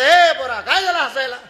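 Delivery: loud, high demand from the merchant. Acted. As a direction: bark at the boy, high and loud. Fat merchant demands why boy laughs. (हसणाऱ्या पोराला शेठजी मोठ्याने विचारतो, चढ्या आवाजात)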